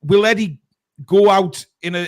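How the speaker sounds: ordinary talk, nothing marked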